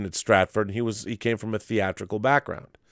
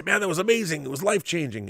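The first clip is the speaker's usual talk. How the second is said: higher-pitched, imitated gushing; mock excited quote; 8 semitones higher, faster than usual. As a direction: pitch it up like someone raving afterwards. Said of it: "he didn't say".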